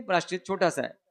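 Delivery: normal talking voice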